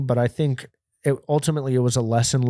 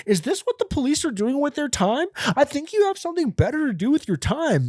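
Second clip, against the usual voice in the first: very high, whiny mock complaint; hammed-up squeaky voice of indignant protest; that guy. Thirteen-year-old in loss prevention room, mock indignant whine. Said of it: "I started being that guy".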